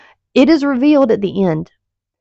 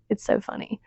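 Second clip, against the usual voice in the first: breathy amused; 11 dB quieter than usual. Real, not acted. Laughing about the ending.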